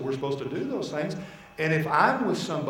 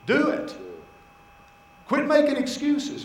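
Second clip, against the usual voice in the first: urging call; 10 semitones higher than usual. Shown as a demonstration. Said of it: "so everybody said".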